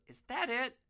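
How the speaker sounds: high, put-on disappointed voice; unimpressed. Hooker looking in the grinder, high unimpressed voice. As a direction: scoff at the tiny stash. Hooker sees small weed stash.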